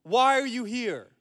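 high, slow sentry's holler; yelling centurion. Acted. Centurion challenging a stranger at the gate, yelling. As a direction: call it out, stretched and commanding. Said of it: "smacks his spear on the ground and yells".

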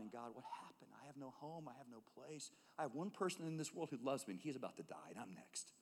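a despairing mother's hushed plea; hushed grief. Acted. Hagar pleading to God over her dying boy, softly grieving.

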